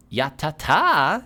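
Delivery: high, slow hag mutter, cutting someone off. Acted. Hag hushes the dwarf-blooded player, slow high mutter. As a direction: drag out a hushing ya ta ta. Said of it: "she mutters to stop you".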